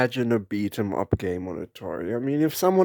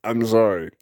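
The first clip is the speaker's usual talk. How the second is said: normal talking voice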